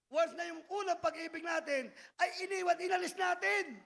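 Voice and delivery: rising preacher's cry; preaching. Real, not acted. Preacher rousing the congregation, impassioned. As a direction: drive the point home to the flock. Pastor preaching at full force.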